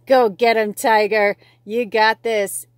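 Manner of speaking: loud pep-talk cheer. Retold or imitated. Spirit's message cheering the viewer on, loud and encouraging. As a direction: urge them on with punchy volume.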